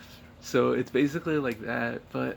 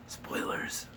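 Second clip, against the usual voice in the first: breathy whispered "spoilers"; whispered aside; 6 dB quieter than usual. Real, not acted. Whispering to viewers about plot reveal. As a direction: whisper it like a secret slip.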